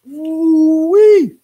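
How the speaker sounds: a high, loud whooping ooh-wee; excited ooh-wee. Real, not acted. Amazed reaction again.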